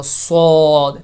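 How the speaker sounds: thick, full صاد with whistle; صاد voiced full and thick; heavy saad. Shown as a demonstration. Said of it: "موٹا کر کے پڑھا جائے گا".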